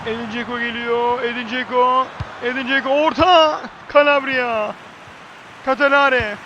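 usual speaking voice

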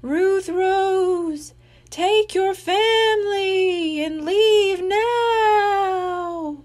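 high, very slow wailing ghost cry; ghostly moan. Acted. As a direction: wail the last warning, stretched and eerie. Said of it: "the ghost moaned its final message".

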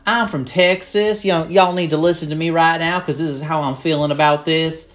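a proud Texan telling everyone to listen; assertive Texas accent. Shown as a demonstration. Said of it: "and then, like".

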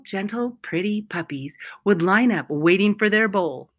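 ordinary delivery

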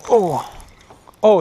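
ordinary delivery

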